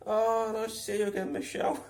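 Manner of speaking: a gushing overfriendly mimic; imitating Steve. Steve greeting a random customer, high gushing mimic.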